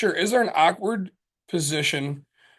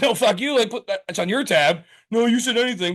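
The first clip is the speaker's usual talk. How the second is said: higher-pitched, playful protest; mock indignant refusal; 6 semitones higher than usual. Refusing a buddy's pricey shot, mock indignant. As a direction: argue back as if cheated, half in jest. Acted bar argument over a tab.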